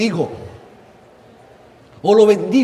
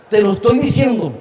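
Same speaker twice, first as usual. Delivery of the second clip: ordinary delivery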